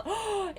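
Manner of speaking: sudden high gasp of delight; surprised delighted gasp; sharp gasp. Real, not acted. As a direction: gasp as if something adorable just happened. Baby koala pops out.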